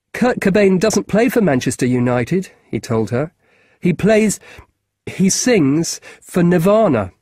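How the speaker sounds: lightly voiced keen schoolboy; a boy asking a grown-up a favour; boy's voice. Marcus voiced higher.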